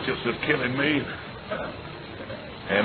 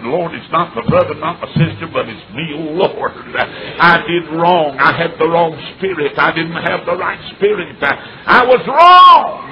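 loud, rising preacher's cry; crying out confession; 3 semitones higher, 8 dB louder than usual. Retold or imitated. A father confessing to God while holding his son, loud. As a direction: pour out a loud confession to the Lord.

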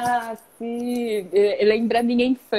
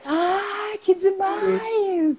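excited; 7 semitones higher, much slower than usual. Real, not acted. Amazed husband designs them.

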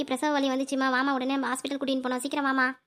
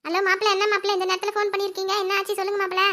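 high-pitched mother-in-law on the phone; higher voice, urgently asking what happened; anxious mother-in-law; 6 semitones higher, 3 dB louder than usual. Acted. Mother-in-law answers son-in-law's unexpected call, anxious.

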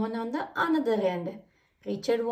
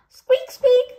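high loud squeak squeak; squeaky roundabout noise; 13 semitones higher, 9 dB louder than usual. Acted. Roundabout turning at the playground, high squeak. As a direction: imitate a creaky roundabout.

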